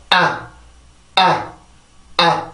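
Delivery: 아 cut short with tightened vocal cords; narrowed, stressed 아. Shown as a demonstration. Teacher showing where stress arises, tightened short 아. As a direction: squeeze the throat on a short 아. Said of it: "이렇게 좁혀줄 때".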